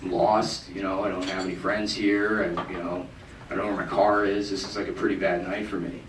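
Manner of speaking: soft, low defeated confession; broken down. Retold or imitated. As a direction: confess it quietly, beaten. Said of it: "sort of broke down".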